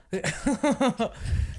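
light far laugh; short burst of laughter. Real, not acted. Laugh heard.

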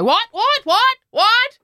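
put-on high, loud nosy kid shouting from upstairs; imitating son. Mother imitating her son calling from upstairs, high and loud. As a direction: squeak it like a kid who must know. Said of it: "I heard Louis upstairs go".

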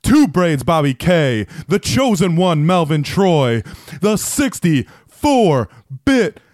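booming big-top announcer intro; ringmaster. Acted. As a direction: stretch the names out, build to a roar. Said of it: "one of the most hype intros".

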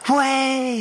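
high, hearty mock greeting. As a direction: greet an old mate with gusto. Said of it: "and do it really loudly".

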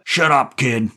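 loud, high-pitched outburst; angry shout. A narrator's reading. Jerry cutting off the boy's story, angry shout. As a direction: shout him down. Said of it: "Jerry shouted".

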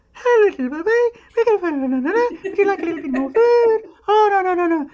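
put-on very high singsong baby talk; high, lilting voice for a baby; motherese. Shown as a demonstration. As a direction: coo it like a mother to her infant. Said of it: "what they call motherese".

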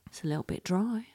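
whispered spider voice; whispering spider. Pretend. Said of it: "then going".

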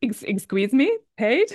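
rising, disbelieving high question; incredulous. Retold or imitated. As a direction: act amazed at the idea. Mock outrage in story.

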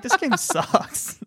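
high, drawn-out grumble through laughter; slow mock complaint, cracking up; laughing complaint. Player's complaint, acted while laughing.

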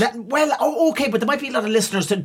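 quick high-pitched protest; fast excited defense. Real, not acted. Defending the jump to pints.

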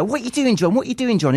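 high-pitched retold mum at the door; lightly acted mum questioning her son; imitating puzzled mum.

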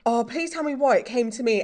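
ordinary talk

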